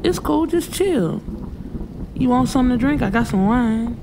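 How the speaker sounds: easygoing, coaxing male voice; laid-back man telling her to chill; relaxed man's voice. A narrator's reading. Man urging her to stay.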